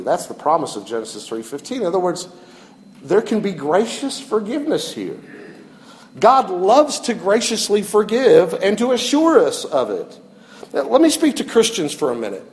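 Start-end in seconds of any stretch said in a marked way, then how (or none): none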